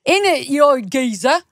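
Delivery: a hammed-up London geezer; mock Cockney. Mock Cockney banter with the co-host. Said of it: "such a bad accent".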